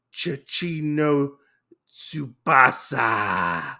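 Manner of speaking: slow dying words. Retold or imitated. Dying man speaks Japanese name.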